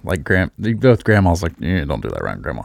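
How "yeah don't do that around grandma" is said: low, soft, quick aside; hushed, hurried parental warning; confidential warning. Retold or imitated. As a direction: caution a kid under your breath. Aside warning kid about grandma.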